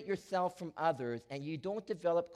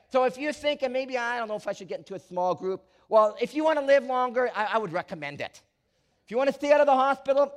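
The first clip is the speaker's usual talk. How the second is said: loud, high preaching push; preaching at full force; 8 semitones higher, 11 dB louder, faster than usual. Acted. Pastor urging the church to join groups, loud preaching. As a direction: sell it to the pews with fire.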